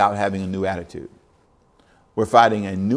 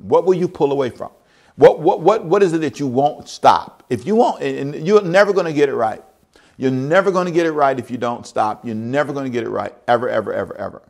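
higher, louder, driving the question home; building preaching; 4 semitones higher, 4 dB louder than usual. Acted. Preacher challenges the congregation, rising to full force. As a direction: press them harder each time.

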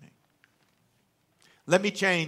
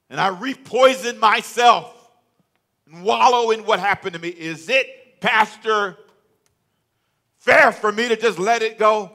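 pleading complaint; 9 semitones higher, 10 dB louder than usual. Acted. Congregant pleads to pastor.

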